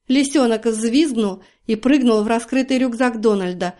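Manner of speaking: ordinary delivery, nothing marked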